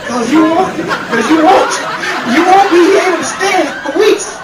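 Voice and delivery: high, loud, broken-up warning; comic threat, strained voice; sputtering outrage. Acted. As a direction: holler it in halting bursts. Sputtering warning after foul coffee.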